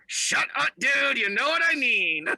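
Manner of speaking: high, tight ventriloquist dummy voice. Pretend. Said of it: "talking in ventriloquism talk".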